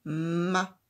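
"ma." said loud and distinct; teacher giving the answer "ma." loudly; answer syllable. Shown as a demonstration. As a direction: give the answer "ma." clearly. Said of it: "C'est la syllabe".

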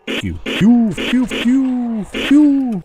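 high, playful pew noises; kid-style pew pew while shooting; mouth gunshot sounds. Pretend. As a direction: fire off toy gun sounds. Mimics gunfire while shooting.

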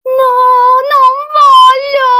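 very high, loud, drawn-out wail; child's desperate refusal. Acted. Child threatened with being cooked and eaten, shrieked wail. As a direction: scream it out like a panicking child.

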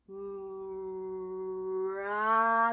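うら with very long held う swelling into English R; R started from う. Shown as a demonstration. Coach explaining tongue position, very slow u-led English-R うら. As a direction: hold う at length, then open into rounded R in うら. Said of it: "うから".

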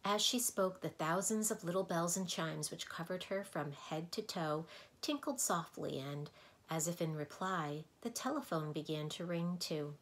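usual speaking voice

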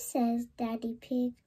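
ordinary delivery, nothing marked

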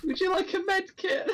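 high falsetto offer. Acted. Offering med kit.

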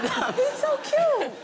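gushing laugh. Real, not acted. Gushing over hairless cat.

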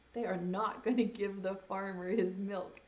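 ordinary delivery, nothing marked

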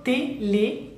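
"Télé" split as té, lé; spaced French "Télé" for learners; syllable-by-syllable. Shown as a demonstration. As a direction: sound out "Télé" with both é clear. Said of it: "doble acento".

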